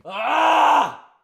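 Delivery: a very high, loud scream of disgust; horrified shout. Real, not acted. Disgusted by her message, letting out a high scream. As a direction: scream out in horror. Reacting to the DM.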